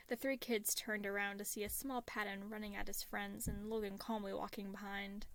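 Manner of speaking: plain reading voice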